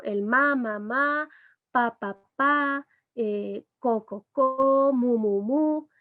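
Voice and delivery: put-on babbling demo in a baby voice; canonical babbling. Shown as a demonstration. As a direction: babble each syllable like an infant. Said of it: "consonante vocal".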